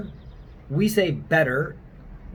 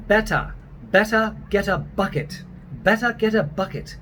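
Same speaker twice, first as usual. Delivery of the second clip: British accent, higher-pitched and R-less; 4 semitones higher than usual. Shown as a demonstration. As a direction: put on a British Monty Python line. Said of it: "to practice the British version".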